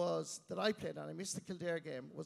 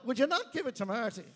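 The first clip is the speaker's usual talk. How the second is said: raised, loud mock exasperation; exasperated sideline shout; 6 semitones higher, 8 dB louder than usual. Lads on the sideline scolding Mick, loud and exasperated. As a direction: call out in imitated exasperation at a team-mate. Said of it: "And all you hear".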